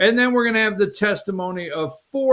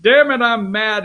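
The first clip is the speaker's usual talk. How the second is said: mock angry outburst; 5 semitones higher, 6 dB louder than usual. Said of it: "he was like".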